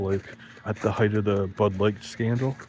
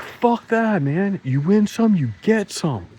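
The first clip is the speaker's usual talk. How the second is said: cocky comeback; 11 semitones higher, 4 dB louder, slower than usual. Retold or imitated. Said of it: "And I'd be like".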